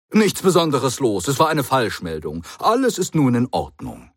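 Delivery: a hint of a brisk, chatty Doctor; airy reassurance, talking fast past it; lightly voiced breezy Doctor. A narrator's reading. The Doctor brushes it off to the Brigadier, lightly voiced and breezy.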